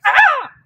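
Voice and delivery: a sudden, high, loud "Ah!" like a dog's warning yelp; sharp loud "Ah!". Shown as a demonstration. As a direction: bark out a startling "Ah!" to stop the bite. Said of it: "I make a loud noise like a dog would do".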